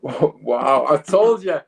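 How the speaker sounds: high, excited, with laughter breaking in; real giddy amazement; elated vindication. Real, not acted. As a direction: gush that you called it. Co-commentator vindicated by the goal.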